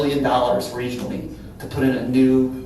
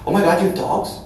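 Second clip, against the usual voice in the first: astonished; 5 semitones higher, faster than usual. Retold or imitated. Mimics employees' astonished feedback.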